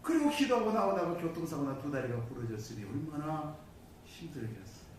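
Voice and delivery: ordinary talk, nothing marked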